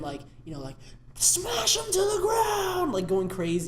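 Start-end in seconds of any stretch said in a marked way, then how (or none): 1.1-2.9 high slow yelled chant; kid yelling. Retold or imitated. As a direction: scream it like a wild fan. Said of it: "this kid in front of us".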